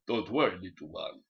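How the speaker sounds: a lightly put-on Mexican hero voice; a hint of the Mexican hero comforting a child; heroic El Nombre. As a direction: reassure the little one like a cartoon hero. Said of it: "El Nombre will fucking swing down from fucking above and go".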